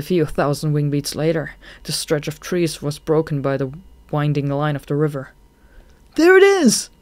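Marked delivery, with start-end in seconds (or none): 6.1-6.9 high, loud joyful shout; excited shout. A narrator's reading. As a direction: shout it out with delight. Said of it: "Shade shouted excitedly".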